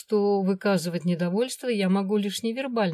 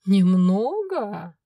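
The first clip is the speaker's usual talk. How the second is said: loud, very slow, mock-horrified echo; mock horror; 7 dB louder, much slower than usual. A narrator's reading. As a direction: pretend to be appalled. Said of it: "в притворном ужасе". (в притворном ужасе, нараспев)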